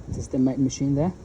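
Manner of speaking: ordinary talk, nothing marked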